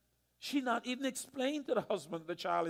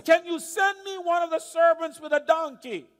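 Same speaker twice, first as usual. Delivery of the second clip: raised, higher call across the field; 7 semitones higher, 6 dB louder than usual. Retold or imitated. Mother calls out to her husband in the field, raised voice. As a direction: call loudly to someone far away. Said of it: "she shouts to her husband in the field".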